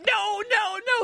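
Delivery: hammed-up horrified onlookers; mock crowd protest. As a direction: squeal a protest. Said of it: "And they went".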